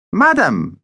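high, courtly greeting; gallant vampire. Acted. Vampire bows to the shopkeeper's wife, gallant. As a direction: greet her grandly in a high character voice.

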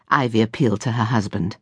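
usual speaking voice

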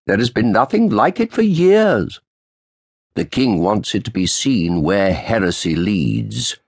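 put-on old official's sharp greeting. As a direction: snap out a cold greeting to the lawyer. Roland's voice.

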